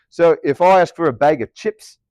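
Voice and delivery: "chips" with the short I kept, in Aussie vowels; Australian accent. Shown as a demonstration. Teacher ordering a bag of chips, in Australian accent. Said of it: "here in Australia".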